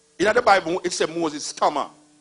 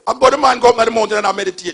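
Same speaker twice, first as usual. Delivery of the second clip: loud, quick and higher; fast rant; 7 semitones higher, 11 dB louder, faster than usual. Real, not acted. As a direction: rattle it off loudly. Ranting fast.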